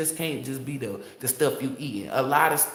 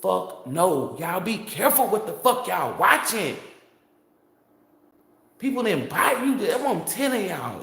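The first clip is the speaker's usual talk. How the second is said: getting angry; 5 semitones higher than usual. Real, not acted. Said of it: "I'm getting pissed off".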